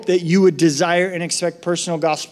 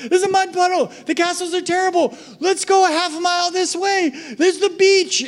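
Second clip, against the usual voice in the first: high exasperated parent voice; pleading parent; 13 semitones higher than usual. Retold or imitated. Parent urging child toward the beach, high pleading. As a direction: plead with a stubborn kid.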